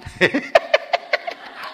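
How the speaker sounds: a high, breathy burst of laughter; high mock laugh. Man laughs at his swollen eyes in the mirror. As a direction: laugh at your own puffy face. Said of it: "하고 웃었어".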